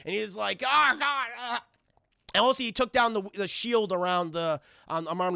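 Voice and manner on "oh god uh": higher choked groan, hammed up; mock gagging. The warden with his teeth gone, mock gagging speech. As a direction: choke the words out like a dying man. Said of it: "he starts fucking gagging and blood's coming out".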